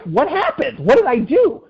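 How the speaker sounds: acting baffled at the angry grandpa; mock bewildered. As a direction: play it up as innocent confusion. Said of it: "So I'm like".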